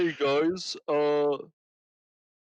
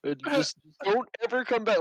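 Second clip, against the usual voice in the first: mock stern boss; 6 semitones higher than usual.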